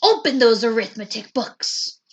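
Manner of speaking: stern command. A narrator's reading. Strict teacher orders class.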